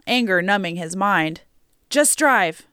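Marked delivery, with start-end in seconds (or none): 1.9-2.7 higher, urgent command; exclaiming. A narrator's reading. Passenger hurrying the driver away, urgent exclamation. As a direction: urge the driver to get moving. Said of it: "Joey exclaimed".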